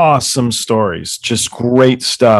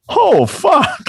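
much higher shocked yell; shocked outburst. Retold or imitated. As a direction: blurt the shock out, pitched way up. Realizing it was Nelson Mandela.

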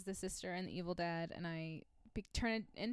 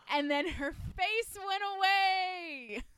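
spooky reveal; 9 semitones higher, 9 dB louder, slower than usual. Acted. Spooky climax of story.

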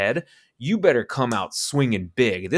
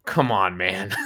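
normal talking voice

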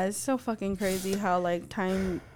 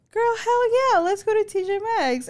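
high, loud hyped-up agreement; big eager retold reply; excited yes; 11 semitones higher, 6 dB louder than usual. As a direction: jump on the invitation, loud and high-pitched. Eagerly accepts daughter's shopping invitation.

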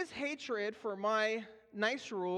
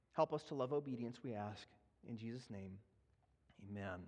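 opening prayer. Acted. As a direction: pray humbly before the congregation. Pastor leads opening prayer.

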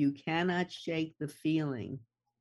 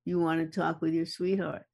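normal talking voice

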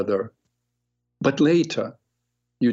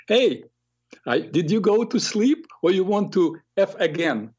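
put-on taunting kid; 7 semitones higher than usual. Rude kid calls again.

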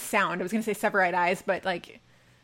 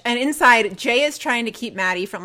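ordinary delivery

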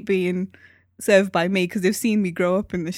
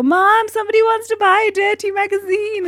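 calling out; 12 semitones higher, 5 dB louder than usual. Retold or imitated. Child calling mum in the shop.

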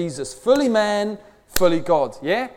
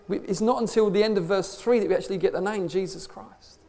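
ordinary talk

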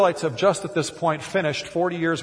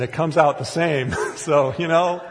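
normal talking voice

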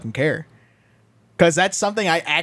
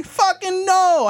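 mock outraged shout; 11 semitones higher, much slower than usual. Peak of a mock rant.

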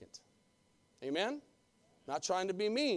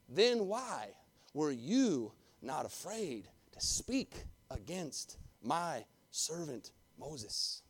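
stern slow rebuke; 4 semitones lower, 5 dB quieter, much slower than usual. Acted. God reprimanding, slow.

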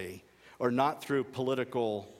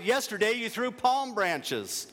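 hurt shout; 9 semitones higher than usual. A narrator's reading. Donkey still shouting, hurt.

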